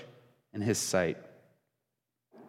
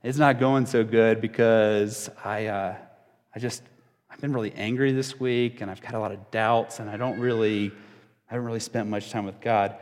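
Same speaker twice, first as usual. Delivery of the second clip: mildly downcast, with hesitant pauses; struggling group member; 4 semitones lower than usual. Acted. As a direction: reluctantly admit to the group it's going badly. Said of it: "the other guy was like".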